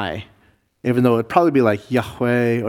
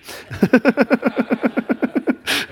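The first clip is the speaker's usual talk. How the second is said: laugh; 11 semitones higher than usual. Real, not acted. Four-letter word joke.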